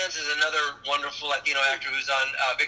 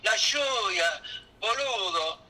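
Argentine slang; 5 semitones higher, slower than usual. Shown as a demonstration. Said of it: "In Argentina".

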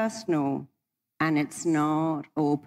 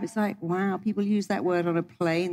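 ordinary delivery

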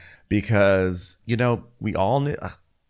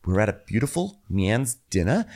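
ordinary talk, nothing marked

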